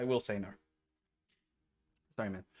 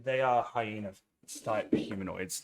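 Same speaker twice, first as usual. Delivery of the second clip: usual speaking voice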